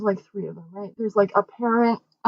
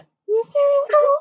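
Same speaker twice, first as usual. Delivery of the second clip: shocked squeal. Retold or imitated. Teen girl squealing at a friend in shock. Retelling girls' shocked reaction.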